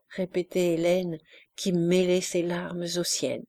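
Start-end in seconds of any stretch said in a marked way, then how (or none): none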